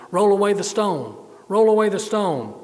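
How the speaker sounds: firm, carrying command; commanding call. Acted. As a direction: give the order out to the crowd, high and loud. Jesus commands those at the tomb.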